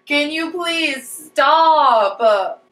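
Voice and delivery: very slow, loud please stop; drawn-out plea. Real, not acted. Vlogger begging the roof worker to stop, drawn-out plea. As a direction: stretch it out in exasperation. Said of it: "yelling at roof noise".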